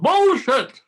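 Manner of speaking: high, loud shouted bullshit; yelled at top of voice. Retold or imitated. Cheeky choirboy interrupting the wizard with a loud yell. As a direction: bellow it out like a cheeky kid. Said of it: "at the top of his voice yelled".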